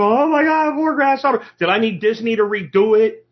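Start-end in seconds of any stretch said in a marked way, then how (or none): 0.0-1.4 mock frightened kid. Mocking a traumatized child.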